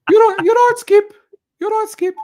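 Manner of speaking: very high, loud put-on voice; mock squeaky impression. Host joking about the accent, squeaky put-on voice.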